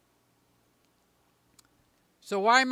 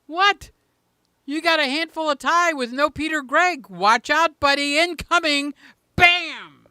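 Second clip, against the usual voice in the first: higher and louder, a playful mock threat; got excited; 7 semitones higher, 6 dB louder than usual. Real, not acted. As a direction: hype yourself up like bracing for a fight. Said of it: "I got a little excited there".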